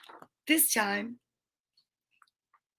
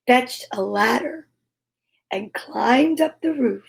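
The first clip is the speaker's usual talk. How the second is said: plain reading voice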